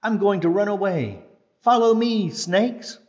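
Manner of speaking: rally the snakes to flee; snake leader calling. Acted. Sarah the snake calls the others to flee. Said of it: "said Sarah".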